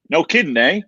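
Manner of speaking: playful Canadian "eh"; Canadian accent. Acted. Joking with the co-host about Canadian speech, Canadian accent. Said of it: "that Canadian type accent".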